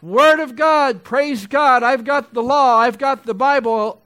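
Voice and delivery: loud, high, slow preacher's cry; rising to a thunderous pulpit cry; preaching at full force. Acted. Preacher mocking the boastful congregation, full force.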